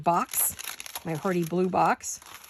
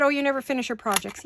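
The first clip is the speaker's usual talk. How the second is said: mock critic; 6 semitones higher, faster than usual. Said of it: "Someone said".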